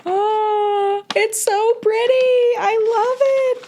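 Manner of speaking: very high, drawn-out gushing; high cooing, overcome with delight; adoring squeal. Real, not acted. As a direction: squeal with delight over a gift. Gushing over fan art.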